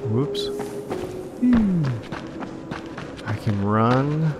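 ordinary talk, nothing marked

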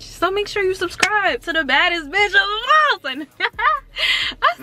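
squealing with laughter through the plug; laughing. Real, not acted. Vlogger hyping her subscribers, laughing. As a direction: laugh it out, overjoyed.

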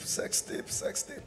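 breathy whispered repeat of sex tape. Acted. Comedian to the crowd, whispering the scandal. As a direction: let it fade into a whisper.

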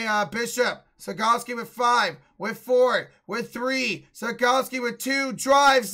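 rising excitement as the clock runs down; play-by-play. Acted. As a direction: build the call toward the buzzer. Building game call to buzzer.

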